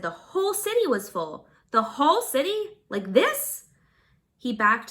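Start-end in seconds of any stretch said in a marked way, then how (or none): 1.7-3.6 surprised. Acted. Rand's dismay at crowds.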